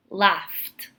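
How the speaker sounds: "laughed" ending in a crisp T; one syllable, "laughed" said as "laft"; final T sound. Shown as a demonstration. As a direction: finish "laughed" on an F then T. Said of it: "laughed with the T".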